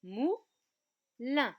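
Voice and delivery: "moulin" loud, as mou, pause, lin; separated syllables. Shown as a demonstration. Teacher confirms two syllables for pupils, loudly split apart. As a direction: isolate each half of "moulin" firmly. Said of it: "Il y a donc deux syllabes".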